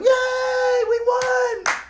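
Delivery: put-on high, loud child's cheer; cheering kids. Kids on his team cheering the win, high and loud. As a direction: shriek it like a winning kid. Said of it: "and they're going".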